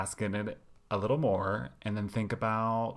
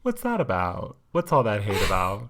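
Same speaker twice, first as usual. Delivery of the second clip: mock outrage; 9 dB louder than usual. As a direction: call it out with mock shock.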